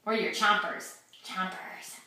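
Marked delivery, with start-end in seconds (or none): 1.3-2.0 playful whisper. Real, not acted. Playful game word to baby.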